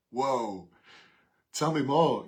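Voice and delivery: loud, high playful wow; hammed-up astonishment, egging him on; mock amazed. Officer hearing about the failed gardening exam, loud mock amazement.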